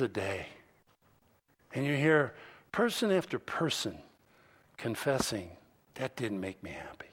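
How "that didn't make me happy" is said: low, very soft imitated admission; quoted in a hushed, low voice; hushed confession. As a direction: drop almost to a whisper, as if confessing. Voices rich people confessing emptiness.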